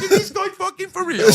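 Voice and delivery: high, loud retold disbelief; incredulous outburst, replayed for laughs. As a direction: exclaim it in high-pitched disbelief. Retelling his disbelief at the request.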